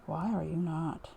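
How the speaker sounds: ordinary talk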